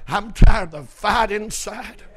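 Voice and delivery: preacher acting a fed-up man; fed-up outcry. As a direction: let the acted frustration pour out. Acting man's weary outcry, building.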